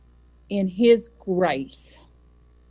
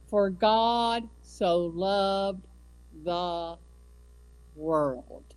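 slow scripture recital; much slower than usual. Acted. Preacher closing the point with the verse, slow and fading. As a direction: let the verse fade out slowly, word by word.

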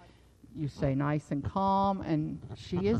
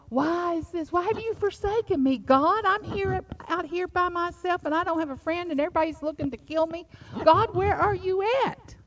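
much higher, pleading wail; anguished cry to God; 11 semitones higher, 4 dB louder than usual. Retold or imitated. Preacher acting David calling out to God. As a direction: cry out alone and desperate. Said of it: "You know".

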